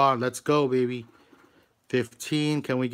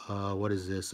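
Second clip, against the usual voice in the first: normal talking voice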